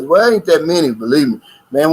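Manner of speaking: usual speaking voice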